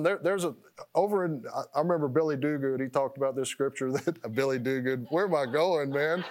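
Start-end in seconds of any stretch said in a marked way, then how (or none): none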